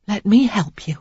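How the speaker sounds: whispered softly; quiet. A narrator's reading. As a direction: hush it to comfort him. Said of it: "she said quietly".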